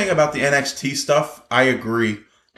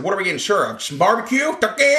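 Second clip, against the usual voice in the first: Otis impression; 7 semitones higher than usual. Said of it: "He said".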